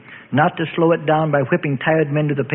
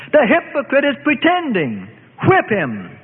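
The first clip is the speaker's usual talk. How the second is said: enraged shout; 8 semitones higher than usual. A narrator's reading. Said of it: "shouted a Sanhedrist enraged".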